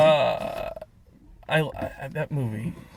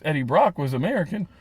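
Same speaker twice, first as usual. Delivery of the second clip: normal talking voice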